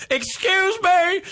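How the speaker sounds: high, slow, affronted "Excuse me"; a stranger drawing herself up, offended; offended woman. Retold or imitated. Offended woman confronts Shane.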